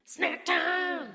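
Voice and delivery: high, shouted snack call; excited announcement. Acted. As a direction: call it out like a jingle.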